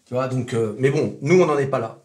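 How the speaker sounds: normal talking voice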